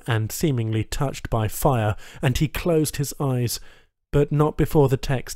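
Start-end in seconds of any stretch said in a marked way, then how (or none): none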